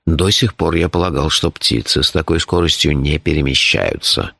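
deep, composed character voice; low, measured, matter-of-fact telling; phlegmatic Shurf. A narrator's reading. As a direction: explain danger with unruffled calm.

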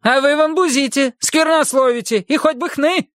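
high, scolding woman through the door; a stern housekeeper demanding entry; bossy housekeeper voice. Acted. Essie scolding. (голос сердитой домработницы)

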